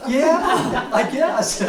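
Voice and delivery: high words through a laugh; laughing reply to a friend. Real, not acted. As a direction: answer while still laughing.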